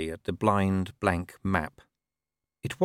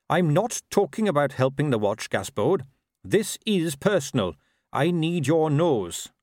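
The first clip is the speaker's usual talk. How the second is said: higher, earnest, measured watchman; Carrot; 6 semitones higher than usual. A narrator's reading. Watchman needing the dog's nose, earnest appeal. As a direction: appeal sincerely, slowing at the end.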